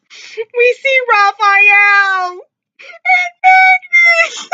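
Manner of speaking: high-pitched, wailing through tears; squeal-cry the words, voice soaring. Real, not acted. Fan overcome by father and son scene, crying. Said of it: "I'm trying not to cry on camera".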